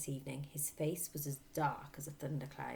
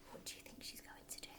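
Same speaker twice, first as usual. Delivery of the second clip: whispered aside; 16 dB quieter, faster than usual. Real, not acted. Aside to listeners.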